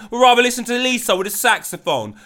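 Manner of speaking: ordinary talk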